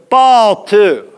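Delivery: mock umpire calling a pitch; lightly voiced umpire, punched out; umpire's call. Umpire to the pitcher after a strike, mock call.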